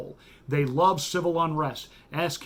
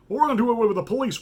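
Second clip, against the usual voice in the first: mocking activist voice; 6 semitones higher, 3 dB louder, much faster than usual. Mock quote of activists.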